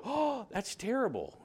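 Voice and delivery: high, prim gasp of mock horror; hammed-up pearl-clutching shock; mock shocked church member. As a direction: play the scandalised churchgoer. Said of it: "members of the church go".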